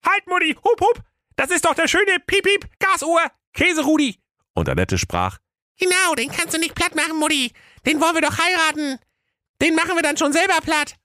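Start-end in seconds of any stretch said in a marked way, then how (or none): 0.1-4.2 very high squeaky daughter with tics; Tourette calling out. A narrator's reading. Daughter stopping Mutti's anvil throw, high ticcing squeal. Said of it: "rief die Tochter Tourette". 5.8-10.9 put-on very high-pitched girlish figure voice; squeaky scheming daughter. A narrator's reading. Daughter talking Mutti out of flattening him, high eager squeak.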